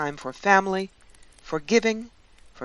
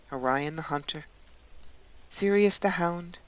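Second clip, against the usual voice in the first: guided meditation; 7 dB quieter than usual. Acted. Guide leading listeners into a Yule winter journey, guided meditation. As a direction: guide them gently, leaving long spaces.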